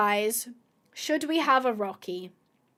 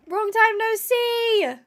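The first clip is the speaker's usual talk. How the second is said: higher, slow, sing-song hello; high, slow, louder long time no see; gushing greeting; 9 semitones higher, 5 dB louder, slower than usual. Real, not acted. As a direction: coo it at your favourite horse. Repeats higher.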